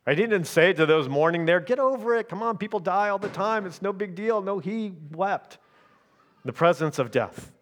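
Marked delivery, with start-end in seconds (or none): 1.6-4.4 high, breezy voice waving grief away; dismissive brush-off. Pretend. Pastor mocking a callous reply to mourners, breezy and dismissive. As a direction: toss it off like it's nothing.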